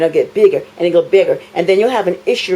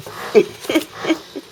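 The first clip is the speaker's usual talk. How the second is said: breathy scornful laugh, higher; mocking laugh; 6 semitones higher than usual. Real, not acted. At the housing staff, a scornful laugh. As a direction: laugh at them, unimpressed. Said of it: "Yeah, I'm laughing".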